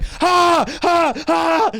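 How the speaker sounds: high, loud yelps of panic; mock panicked gasps. Boys sent running to the basement, mock-panicked gasps. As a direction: imitate frantic kids gasping.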